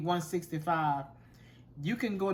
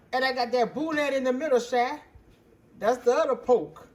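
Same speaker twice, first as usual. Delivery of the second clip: loud, higher teasing punchline; excited joke; 7 semitones higher, 8 dB louder than usual. Real, not acted. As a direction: build up to the joke and belt it out. Joking about the other pork.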